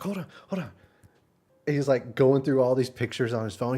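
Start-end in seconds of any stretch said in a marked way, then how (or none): 0.0-0.7 high hurried mock voice; imitating the cook. Vlogger imitating the cook rushing off, higher mock voice. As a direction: rush it like the eager cook.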